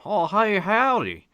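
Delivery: high, loud hammed-up Southern cowboy accent; mock Southern accent. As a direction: parody a simplistic Southern cowboy's howdy. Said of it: "I hate Southern accents".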